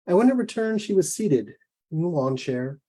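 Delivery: plain reading voice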